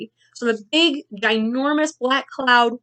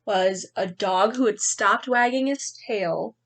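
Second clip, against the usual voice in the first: put-on lively read-aloud for children; story narration. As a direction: read it like a picture book to kids. Teacher reads picture book aloud.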